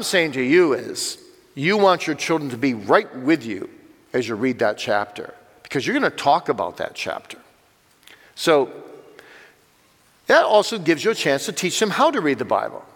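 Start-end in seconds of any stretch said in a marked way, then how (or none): none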